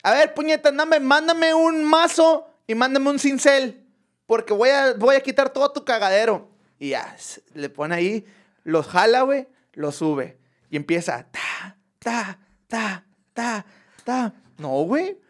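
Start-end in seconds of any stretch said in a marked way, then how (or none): 0.0-6.5 high, exasperated shouted order; annoyed boss calling down. Retold or imitated. Master builder demanding tools from his helper, annoyed. Maestro grita órdenes desde arriba. 11.3-14.3 short spaced "ta" knocks; imitated hammer blows. Master builder chiselling the wall, imitated knocking. Imita golpes de mazo y cincel.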